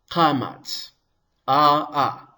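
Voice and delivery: KAMATZ, then its two long AH sounds alone; sounding out vowels. Shown as a demonstration. Teacher helping learners remember the sound, sounded-out AH, AH. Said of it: "has two kamatzes in it".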